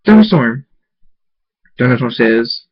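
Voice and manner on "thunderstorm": high, loud falling shout; screamed name. A narrator's reading. Mare calls out to Thunderstorm in the hallway, screaming. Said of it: "Shirley screams".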